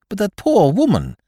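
high-pitched, loud, concerned objection; distressed protest. Acted.

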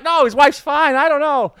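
high, loud panicked backpedal; flustered high denial. Retold or imitated. To Peter, backtracking about the wife, panicked and high. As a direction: scramble to undo it. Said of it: "I'm like".

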